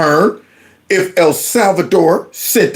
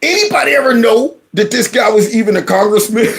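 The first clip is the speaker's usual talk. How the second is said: higher pitch, breaking into laughter; laughing incredulity; 5 semitones higher, faster than usual. Real, not acted. Host scoffing at an unknown congressman, real laughter rising. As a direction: ask in disbelief, laughter taking over.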